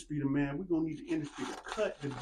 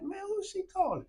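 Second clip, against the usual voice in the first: put-on much higher, puzzled kid voice; confused kids. Kids in the yard wondering who grandma is calling, puzzled. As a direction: play a bewildered kid looking around. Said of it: "Everybody looking around like".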